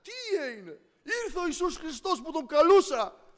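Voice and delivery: high, loud, eager telling of the news; a brother bursting to share a miracle; excited brother on the phone. Retold or imitated. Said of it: "τον παίρνει τηλέφωνο και του λέει".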